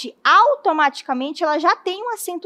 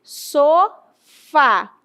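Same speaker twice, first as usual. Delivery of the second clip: "Sofá" in two drawn-out syllables, "fá" strongest; stress on FÁ; 4 dB louder than usual. Shown as a demonstration. Teacher asking which syllable is stronger, split "Sofá". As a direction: repeat "Sofá" slowly, leaning on the final "fá". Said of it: "com certeza que é o fá".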